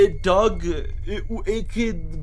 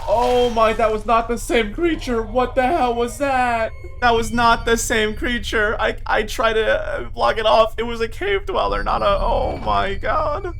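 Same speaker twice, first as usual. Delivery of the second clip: startled fright; 6 semitones higher, 4 dB louder than usual. Real, not acted. Creeper-like mob attacks in cave.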